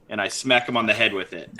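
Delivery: ordinary talk, nothing marked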